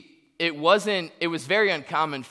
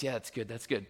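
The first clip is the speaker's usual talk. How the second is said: muttered approval; 4 semitones lower, 10 dB quieter than usual. Retold or imitated. Approving own rehearsed speech.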